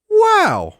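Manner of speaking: exaggerated, smitten exclamation; awestruck wow. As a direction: let out an acted gasp of wonder. Acting out being struck by beauty.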